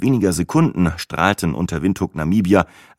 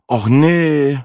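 louder, higher dismayed groan; 8 semitones higher, 5 dB louder than usual. A narrator's reading. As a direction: moan it in dismay. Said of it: "stöhnte ich".

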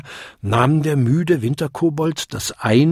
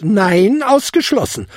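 high, loud, emphatic refusal; cutting in; 9 semitones higher, 5 dB louder than usual. A narrator's reading. Godmother refusing the kobold's request, cutting in. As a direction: cut him off imperiously. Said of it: "unterbrach sie ihn".

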